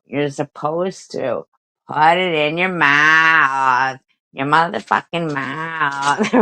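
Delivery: slow, emphatic, spelling it out; slow lecturing. Real, not acted. Slowly scolding the teabagger over technique. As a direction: lay it out slowly like a scolding teacher. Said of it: "lecturing slowly, word by word".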